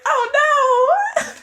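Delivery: very high squealed "oh no"; mock dismay. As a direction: wail it up high in playful dismay. Mock dismay at retrograde comment.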